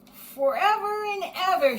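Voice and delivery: high, loud joyful shout. A narrator's reading. Little Bear shouts his promise to Edith, joyfully loud. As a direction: shout it out with joy. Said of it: "shouted Little Bear".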